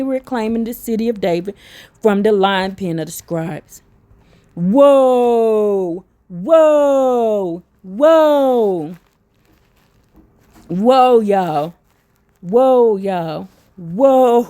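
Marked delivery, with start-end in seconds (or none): 4.5-14.4 long drawn-out whoas, sliding down; amazed exclaiming. Real, not acted. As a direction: let each whoa ring out in amazement. Overwhelmed by what was just read.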